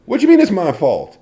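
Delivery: high, loud comic protest; mock outraged denial. Blamed by the family, mock outraged denial. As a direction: protest the blame in hammed-up disbelief. Acting out a defensive family member.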